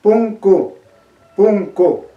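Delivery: punku with the final u opened toward o; quechua word demo. Shown as a demonstration. Teacher models punku for students, careful and clear. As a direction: say punku, then punku again, last vowel open. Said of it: "Entonces suena punku".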